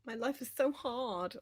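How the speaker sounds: moaning to viewers about broken tech, mock whine. Self-mocking to chat about her computer, mock whine. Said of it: "I can go".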